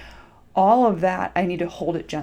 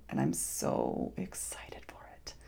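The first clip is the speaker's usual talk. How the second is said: excited breathy whisper, slower; 13 dB quieter, slower than usual. Real, not acted. Excited secret aside to listeners.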